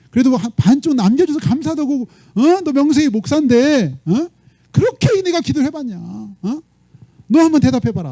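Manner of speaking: high-pitched, hammering rhetorical questions; God pressing hard. Retold or imitated. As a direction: scold as an acted God, pushing for answers. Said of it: "하나님도 막 이렇게 몰아붙일 수 있나 봐요".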